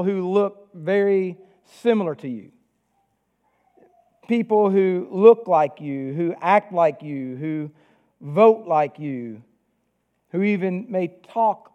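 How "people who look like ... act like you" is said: ordinary talk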